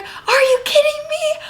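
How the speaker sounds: high-pitched mock outraged squeal; imitating irate coach. Imitating a coach going crazy at a call, outraged and high. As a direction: squeal it in exaggerated disbelief. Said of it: "our coach went crazy".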